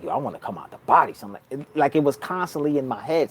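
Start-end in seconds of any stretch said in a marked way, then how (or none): 0.0-1.3 inner thought voice. Retold or imitated. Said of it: "I'm like".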